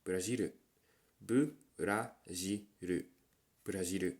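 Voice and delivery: Brazilu, the middle take slow and drawn out; Japanese pronunciation. Shown as a demonstration. Drilling learners on Brazilu. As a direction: repeat Brazilu in Japanese sounds. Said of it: "the Japanese version is the same".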